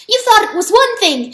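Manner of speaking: high, loud frantic mouse cry; squeaky mouse cry to the animals. A narrator's reading. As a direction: shout it out, desperate to be heard. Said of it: "he cried".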